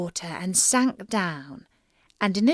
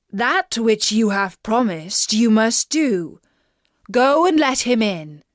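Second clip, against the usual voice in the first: louder, firm command; stern king; 5 dB louder than usual. A narrator's reading. King ordering his daughter to keep her promise, firm and loud. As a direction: lay down the law as a king.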